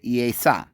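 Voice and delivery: Yesa as YAY-sah, two syllables; Yesa: ee-AY-sah, falling. Shown as a demonstration. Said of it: "Arabic peoples would say".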